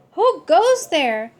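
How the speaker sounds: loud slow call into space; holler. Acted. Scapito hollering at a passing comet, loud and slow. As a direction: call out who goes there. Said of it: "hollered Scapito".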